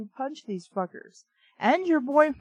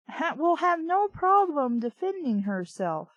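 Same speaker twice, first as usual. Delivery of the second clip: usual speaking voice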